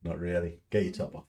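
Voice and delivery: low, soft private remark; quiet banter. Real, not acted. Answering the partner in the room, low quiet banter. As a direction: drop low and soft, off to the side.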